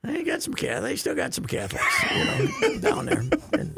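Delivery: high, laughing words. Real, not acted. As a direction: giggle through the joke about Catholics. Laughing about the bishop.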